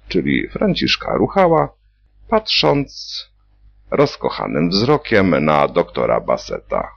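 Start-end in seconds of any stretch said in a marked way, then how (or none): none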